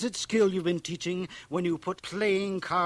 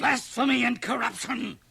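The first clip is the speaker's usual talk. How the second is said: louder, higher thundering condemnation; outraged; 7 semitones higher, 7 dB louder than usual. Acted. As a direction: hurl the accusation at him. Minister rages at boy.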